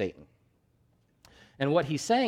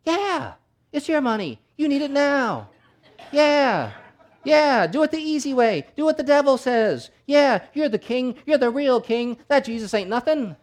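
high, sly tempting whisper voice; tempter voice; 10 semitones higher, 6 dB louder than usual. Retold or imitated. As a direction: voice the devil on your shoulder. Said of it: "that little voice in his head going".

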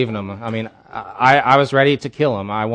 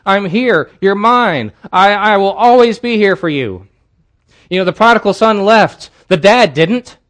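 much higher and louder, a preacher's climax; preaching at full force; 8 semitones higher, 8 dB louder than usual. Acted. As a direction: preach it out, building to a cry.

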